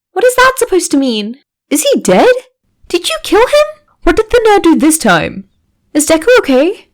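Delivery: high, frantic questions tumbling out; panicked outburst. Acted. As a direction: voice a whole class losing it. Class panics about missing classmate.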